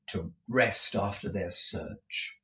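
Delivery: usual speaking voice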